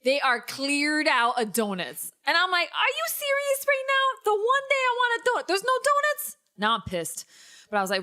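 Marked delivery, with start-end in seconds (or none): 2.7-6.4 high, indignant disbelief; hammed-up high-pitched exasperation; mock outrage. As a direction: protest the injustice in a much higher voice. Acted outrage at no donuts.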